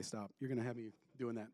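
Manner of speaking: ordinary talk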